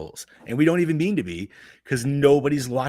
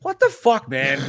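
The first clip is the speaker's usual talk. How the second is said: stunned disbelief; 7 dB louder, slower than usual. Real, not acted. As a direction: react as if you can't believe it worked. Reacting to the ticket-dodge story.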